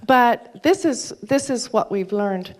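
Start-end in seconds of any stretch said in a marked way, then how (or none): none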